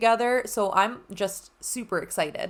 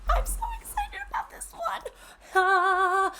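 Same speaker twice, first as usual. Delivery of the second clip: high sing-song excitement; giddy singsong; excited; 10 semitones higher than usual. Real, not acted. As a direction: lilt it like a happy tune. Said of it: "I am elated".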